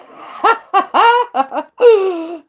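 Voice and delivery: high, loud burst of laughter; a real laugh after her own joke. Real, not acted. Laughing at her joke to a viewer, loud and high.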